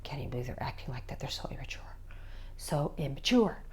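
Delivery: whispered scornful muttering; hushed, low, exasperated aside; eye-rolling disdain. Acted. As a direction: grumble under your breath at a childish teen. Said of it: "Don't be rolling your eyes like".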